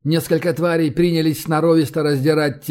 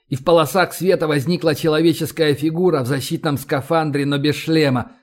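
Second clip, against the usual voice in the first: ordinary delivery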